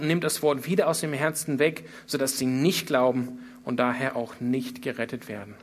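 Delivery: ordinary talk, nothing marked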